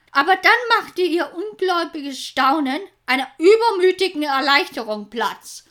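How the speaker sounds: ordinary delivery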